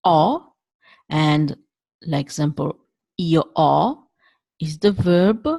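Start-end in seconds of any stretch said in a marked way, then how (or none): 0.0-0.5 O said wide and open; Italian open O. Shown as a demonstration. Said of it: "The open sound is".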